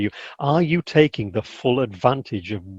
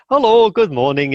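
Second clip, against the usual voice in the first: broken English; 5 semitones higher, 7 dB louder than usual. Retold or imitated. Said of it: "in his broken English".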